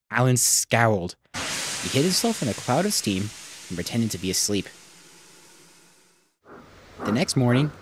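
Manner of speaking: ordinary delivery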